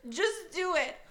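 high, teary, over-the-top despair; mock sobbing plea. Friend mimics a sobbing prom date begging Pat, mock crying. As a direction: ham up a weeping girl begging.

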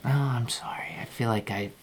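hushed apology; whisper. Acted. Husband whispering an apology to his wife. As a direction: whisper it so the waiter can't hear. Couple apologizing to each other privately.

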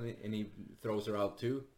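ordinary delivery

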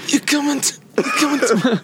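raspy mumbling mob boss; Godfather impression. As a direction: do a bad Brando Godfather. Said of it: "trying to do The Godfather".

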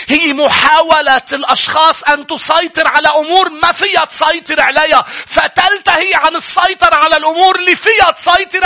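fiery Arabic sermon climax; preaching at full force. Acted. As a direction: preach it hard, building to a roar.

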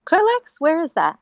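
high, surprised question; puzzled by an unknown name. Real, not acted. Unfamiliar place name, questioning.

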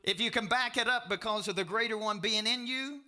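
loud, high-pitched pulpit delivery; full-force preaching. Real, not acted. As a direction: preach it out loud to the whole room.

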